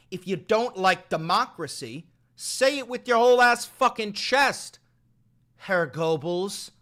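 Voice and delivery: loud, rising yell daring him to own it; yelled taunt. Real, not acted. Streamer yelling at the clip of Stephen Miller, loud taunt. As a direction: shout the dare right at him.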